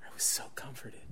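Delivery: soft whispered relief; whispered comfort; relieved whisper. Real, not acted. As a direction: let it out as a hushed sigh of relief. Relief after repentance.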